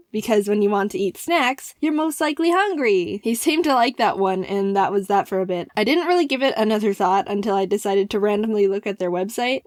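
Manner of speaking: ordinary delivery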